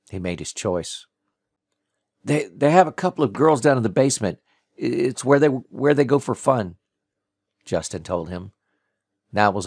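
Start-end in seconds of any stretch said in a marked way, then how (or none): 2.2-6.8 higher, louder, stammering admission; scared captive talking to save himself; nervous confession. A narrator's reading. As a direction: stumble over the first words, pleading and raised. Captive pleads, tells what he knows.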